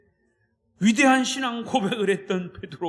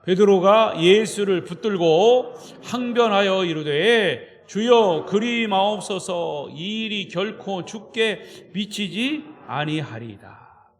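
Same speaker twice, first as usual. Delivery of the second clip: slow, louder, measured Bible reading; solemn scripture reading; 6 dB louder, slower than usual. Acted. Preacher reading the verse to the congregation, solemn. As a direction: read the verse slowly and weightily.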